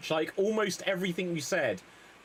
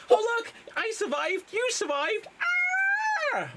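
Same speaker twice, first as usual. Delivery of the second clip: much higher, excited character voice; high relieved cry; 3 dB louder than usual. Acted. Survivor calling out relieved, high excited voice. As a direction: squeal it with relief.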